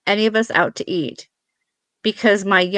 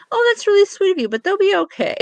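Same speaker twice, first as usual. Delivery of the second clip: high-pitched, sugary politeness; polite refusal; 11 semitones higher than usual. Retold or imitated. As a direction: politely turn down a kind stranger, voice up high. Acted polite refusal to strangers.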